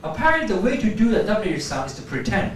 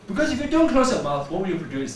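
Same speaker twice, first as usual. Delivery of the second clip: ordinary talk, nothing marked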